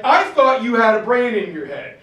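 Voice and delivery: loud, high, fuming rebuke of a subordinate; raging at a colleague who went behind your back; furious outburst. Retold or imitated. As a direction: blow your stack at him. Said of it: "he blows his stack".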